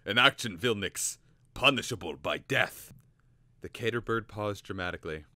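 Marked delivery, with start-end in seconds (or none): 0.0-2.9 loud grave threat. Acted. Professor's threat continues rant.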